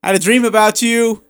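imitating a coworker bursting with news; lightly voiced excited colleague; mock eager coworker. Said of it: "Hey, Eric".

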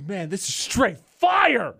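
drawn-out boast leaping up on "fire"; slow, hyped-up brag with a big finish; self-hyping rapper. Acted. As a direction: play your own biggest fan. Acting rapper hyping own song.

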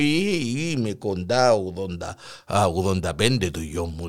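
put-on old grandpa's voice. Old grandpa answers slowly.

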